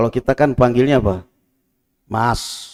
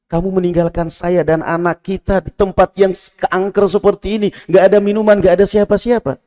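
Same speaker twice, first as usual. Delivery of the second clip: high, louder desperate plea; pleading wife; 9 semitones higher, 5 dB louder than usual. Acted. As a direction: cry after a husband who walks away. Hajar pleads with Ibrahim.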